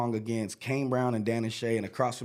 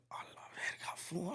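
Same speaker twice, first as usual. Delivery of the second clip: whisper; 6 semitones higher, 15 dB quieter than usual. Retold or imitated. Thrilled aside after meeting Luke.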